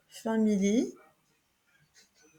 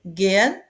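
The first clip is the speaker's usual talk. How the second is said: gehen with ge hit loud; stress on ge; 6 dB louder than usual. Shown as a demonstration. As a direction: punch the ge of gehen. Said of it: "on l'accentue sur le son ge".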